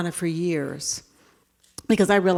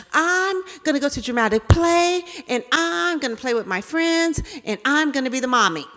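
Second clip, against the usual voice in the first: put-on bossy little girl; 7 semitones higher than usual. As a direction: play the pushy four-year-old announcing her plan. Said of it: "She goes".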